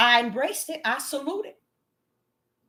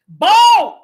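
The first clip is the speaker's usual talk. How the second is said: a sudden loud cry of the word; shouted word; 4 semitones higher, 13 dB louder than usual. Real, not acted. Preacher to women, a shouted Bald.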